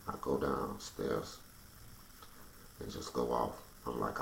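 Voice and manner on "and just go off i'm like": ordinary talk, nothing marked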